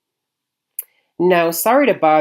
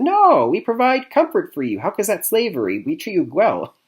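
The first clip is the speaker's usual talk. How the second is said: high-pitched, louder mock denial; indignant mock protest; 8 semitones higher, 3 dB louder than usual. Company boss brushing off slavery accusations from protesters, mock-indignant. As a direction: protest in an exaggerated, higher voice.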